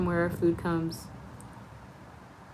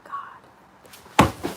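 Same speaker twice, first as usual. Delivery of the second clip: whisper. Real, not acted. Whispered aside while eating.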